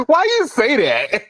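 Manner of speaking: amused, giggling question; laughing. Real, not acted. Laughs at old man joke.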